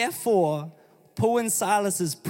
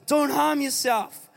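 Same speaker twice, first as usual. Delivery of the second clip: raised higher, urgent call; pitched up urgent cry across the prison; Paul shouting; 5 semitones higher than usual. A narrator's reading. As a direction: call out to stop the jailer. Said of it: "But Paul shouted".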